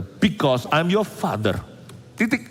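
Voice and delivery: slow, deliberate fatherly pronouncement; lightly acted stern dad, drawn out; authoritative father. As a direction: imitate a father laying down the law, word by word. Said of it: "tunjukkan kepada mereka siapa yang berkuasa".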